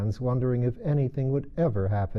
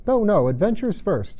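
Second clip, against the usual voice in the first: much higher and louder, insistent; impatient; 10 semitones higher, 6 dB louder than usual. A narrator's reading. Gryphon overruling the Mock Turtle, impatient. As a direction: cut him off eagerly. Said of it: "in an impatient tone".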